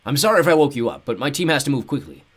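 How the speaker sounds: loud booming voice. A narrator's reading. Chubby man explaining to the orphans, loud and booming. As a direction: boom it across the hall. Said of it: "in a loud, booming voice".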